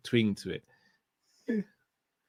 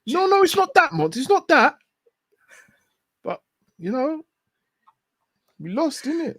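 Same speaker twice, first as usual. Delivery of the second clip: much higher, mocking a player after a loss; high put-on footballer voice, a bit louder at first; high-pitched footballer impression; 3 dB louder than usual. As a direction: squeak it out like the player making excuses. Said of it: "Well, I don't know, bruv".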